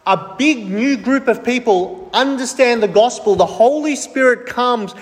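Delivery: ordinary delivery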